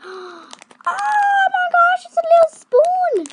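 gasp, then high loud squeal. Real, not acted. Unboxing a tiny spoon for viewers, a high gasping squeal.

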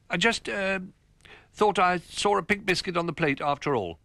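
high, hesitant little fibber; flustered Rabbit. Acted. Rabbit making excuses to Old Bear, flustered and high. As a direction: stumble through a made-up excuse.

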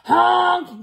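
big yelled truck horn; loud, high, bossy honk; really loud honk. Acted. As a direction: blast it out like a dump truck. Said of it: "That means it's really loud".

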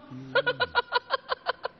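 high, staccato burst of laughter; delighted cackle. Acted. Salesman overjoyed at a sudden sale, cackling.

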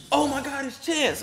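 high excited fan gasp; starstruck fan. Retold or imitated. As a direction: squeal it like a fan who just spotted a star. Fans spotting him, excited quote.

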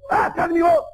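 high, loud mock bellow; acted full-voiced retort; father yelling. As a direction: yell back as the indignant father. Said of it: "usted me está gritando".

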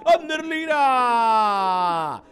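long held, loud call; drawn-out name call. Real, not acted. Goal scorer call.

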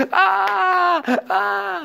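two long, very high "aah" yells; mock tantrum scream. Teacher asks student about throwing things, mock tantrum screams. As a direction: act out a child's fit with high held cries. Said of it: "¿Tú tirabas cosas".

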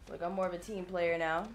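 ordinary talk, nothing marked

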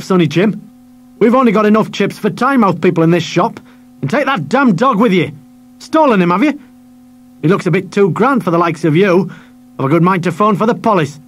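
high, loud bullying harangue; bullying shopkeeper; bullying rant. Acted. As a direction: threaten the boy with police. Shopkeeper threatens the boy.

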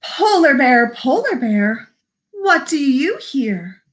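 slow, lilting story reading; picture-book read-aloud. Acted. Librarian reading Polar Bear to children, slow lilting read-aloud. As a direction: read it slowly with singsong emphasis.

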